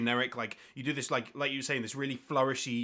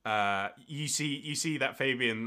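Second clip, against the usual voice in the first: ordinary delivery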